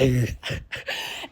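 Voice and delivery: sudden breathy burst of laughter. Real, not acted. Laughing at a friend who overreacts, breathy laugh. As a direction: laugh right in their face.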